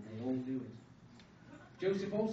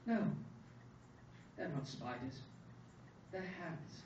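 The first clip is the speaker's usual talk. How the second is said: softer, matter-of-fact correction; simply and smartly; 7 dB quieter than usual. A narrator's reading. Son corrects his father, simply and smartly. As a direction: explain it simply and quietly. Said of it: "simply and smartly".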